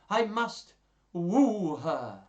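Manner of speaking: slow, loud, high declaration of courtship; lovelorn suitor. Acted. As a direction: declare it grandly and slowly.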